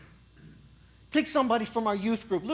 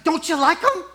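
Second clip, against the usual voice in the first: louder, higher, teasing question; the Lord asks; 8 semitones higher, 9 dB louder than usual. Acted. The Lord teases the prophet, louder and higher. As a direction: nudge the prophet playfully.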